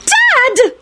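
put-on very high girlish scream. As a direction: shriek it in horror. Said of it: "Ginny screamed".

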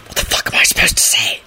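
urgent unvoiced hiss; irritated stage whisper. A narrator's reading. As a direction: whisper it sharply under your breath. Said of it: "he hissed to her".

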